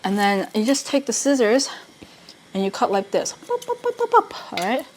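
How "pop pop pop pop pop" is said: playful pop sounds. Real, not acted. Playful sound while cutting seaweed.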